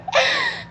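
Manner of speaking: sharp high gasp of delight; excited gasp. Real, not acted. Streamer catching her breath in excitement. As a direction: gasp in thrilled surprise. Thinks she got it.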